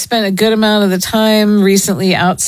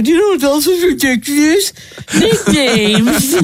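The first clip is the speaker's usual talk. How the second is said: raised, laughing mock reveal; mock announcement; 6 semitones higher than usual. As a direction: play it as a big reveal. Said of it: "That I was gonna say to you".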